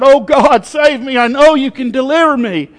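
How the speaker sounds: high, loud plea to God; impassioned cry of a man in a flood. Acted. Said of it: "he's still crying out".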